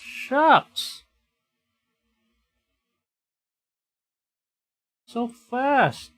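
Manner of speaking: loud, high yelps of panic; alarmed outcry. Real, not acted. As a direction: cry out as the attack lands. Creature attacks again.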